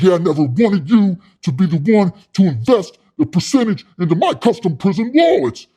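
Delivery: imitating a wounded blowhard pitchman; defiant comeback. Rejected pitchman lashes back.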